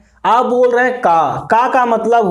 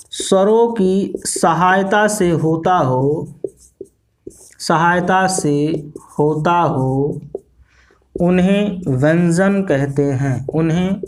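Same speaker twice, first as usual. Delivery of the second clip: slow dictation; 3 semitones lower, slower than usual. Shown as a demonstration. Teacher dictating slowly to class.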